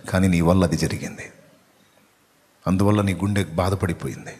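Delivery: low, subdued pastoral voice; intimate preaching. Real, not acted. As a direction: speak quietly to one grieving listener's heart. Preacher speaks intimately of weeping.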